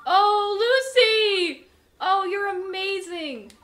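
high, loud delighted cry; elated praise. Real, not acted. Player praising his horse Lucy, high elated cry. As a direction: gush with relief.

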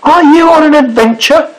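high, eager question; curious sailor. A narrator's reading. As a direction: ask brightly as the sailor. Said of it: "said the sailor".

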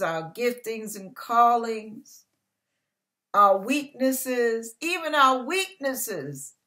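a preacher's swelling call over the congregation; building sermon climax. Acted. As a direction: drive the list home like a preacher.

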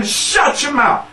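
high, punched comeback; firm retort. Retold or imitated. Coaching a girl to answer her bully, firm retort. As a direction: coach a child to snap back. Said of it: "I said, "Tell him".

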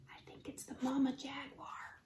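very soft, whispered warning; barely audible, secretive whisper; hushed whisper. A narrator's reading. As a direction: whisper so the animal won't hear. Hushed fear as jaguar approaches.

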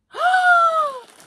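big high gasp of delight; sharp excited gasp. Real, not acted. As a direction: gasp in real surprise. Sees gift in bag.

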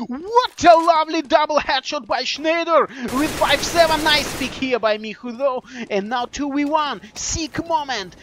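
excited esports caster, high and loud; overly emotional. Real, not acted. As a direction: call the big play in real, rising excitement. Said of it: "sorry if I'm too emotional".

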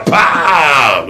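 drawn-out smack cry; mock slap yell; slap shout. Father smacks kid, retold.

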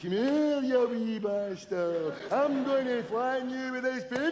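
slurred swollen-tongue ghost voice; stung-tongue ghost. Retold or imitated. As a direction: talk like a bee stung your tongue. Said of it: "That's him stung in the tongue".

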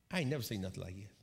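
low, quick, soft aside; hushed awe. Retold or imitated. Preacher watching an angel hold the wing, hushed awe. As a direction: say it under your breath, astonished.

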